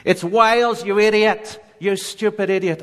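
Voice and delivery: high, loud yelled retort; angry woman screaming a correction; furious scream. Retold or imitated. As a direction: shriek it back at him, outraged. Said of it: "screamed back at me".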